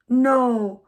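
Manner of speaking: normal talking voice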